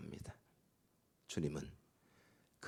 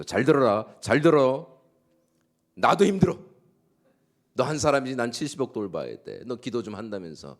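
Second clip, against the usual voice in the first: higher, loud, exasperated scolding; irritated scolding God; 3 semitones higher, 7 dB louder than usual. Pretend. Pastor voicing an annoyed God scolding a weeping believer, mock angry. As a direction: snap at a crying child in mock irritation. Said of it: "막 짜증을 내시면서".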